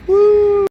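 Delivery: high, loud "woo"; long held cheering whoop; celebratory whoop. Real, not acted. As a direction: let out a high, sustained whoop. Cheering old normal coming back.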